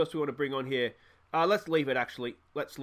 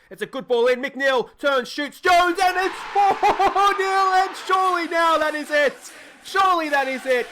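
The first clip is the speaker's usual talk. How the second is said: much higher and louder, shouting the goal like a commentator; ecstatic goal call; 11 semitones higher, 8 dB louder than usual. Real, not acted. Calling the goal to his viewers, ecstatic shout. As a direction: roar it as the winner goes in.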